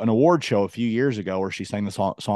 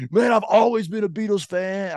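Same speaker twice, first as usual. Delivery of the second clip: high, put-on fanboy voice; mock latecomer fan; 9 semitones higher than usual. Mocking late Beatles converts, put-on fan voice. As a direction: imitate a boastful new fan, pitched up. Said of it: "Like, they're like".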